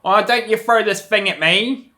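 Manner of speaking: a retold Australian teacher scolding a student, mock high voice. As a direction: ham up the shrill schoolteacher telling him off. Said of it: "She's like".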